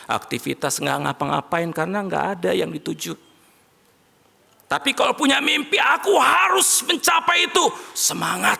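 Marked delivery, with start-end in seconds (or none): 4.7-5.8 rousing shout. Acted. Preacher to congregation, a high rousing shout. 5.8-8.6 high, shouted determined vow; fired-up resolve. Retold or imitated. Voicing a dreamer to the congregation, a fired-up shout.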